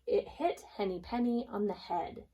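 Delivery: ordinary delivery, nothing marked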